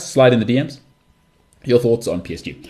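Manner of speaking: ordinary talk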